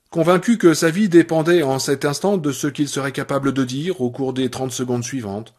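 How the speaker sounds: usual speaking voice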